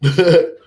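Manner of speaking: brief high, loud chuckle; sudden little burst of laughter; short laugh. Real, not acted. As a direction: let out a quick amused laugh. Laughs after naming prostitution.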